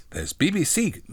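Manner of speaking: put-on tentative childlike character voice; lightly voiced John. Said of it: "John says".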